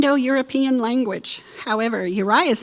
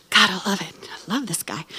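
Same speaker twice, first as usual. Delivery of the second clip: whispered aside; 3 semitones lower, 4 dB quieter than usual. Real, not acted. Admiring aside about Uriah.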